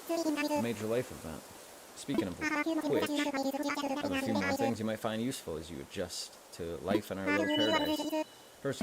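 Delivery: chuckling southern shopkeeper, light twang; Tom Nook voice. Acted. Streamer voicing Tom Nook giving the player the Nook phone, folksy southern twang. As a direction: hand over the phone like a proud country merchant. Reading Tom Nook's dialogue.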